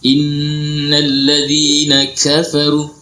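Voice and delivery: "innal ladzina kafaru" recited very slowly with a breathy k; slow Quran reading; Quranic recitation. Shown as a demonstration. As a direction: chant it slowly as tajwid. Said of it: "Jadi kalau kita baca".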